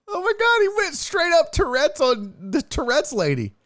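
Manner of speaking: high, excited exclamation; excited disbelief. Real, not acted. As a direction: shriek it out in disbelief. Reacting to tweet.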